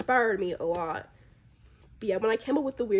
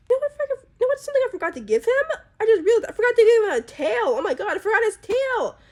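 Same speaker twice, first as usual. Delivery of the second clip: much higher and louder, sudden realization; real surprise; 12 semitones higher, 8 dB louder, faster than usual. Real, not acted. Artist suddenly realizes her character lacks a tail, surprised and louder. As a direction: blurt it out as it dawns on you.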